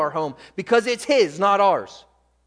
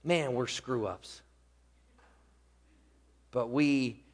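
lower, softer sermon close; subdued preaching; 5 semitones lower, 5 dB quieter than usual. Real, not acted. Preacher reminds congregation of their living intercessor, subdued preaching. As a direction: bring it down after the climax.

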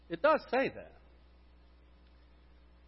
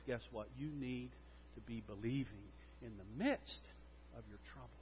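much lower and softer, an understated Jesus; Jesus' gentle reply; 7 semitones lower, 17 dB quieter than usual. Retold or imitated. Preacher voicing Jesus gently answering a weary believer, low and soft. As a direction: say it low and soft, like a quiet correction.

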